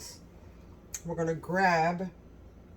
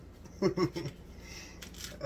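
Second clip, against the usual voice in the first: soft laugh; 6 dB quieter than usual. Real, not acted. Laughing between bites.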